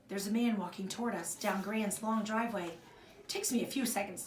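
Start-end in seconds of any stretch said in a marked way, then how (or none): none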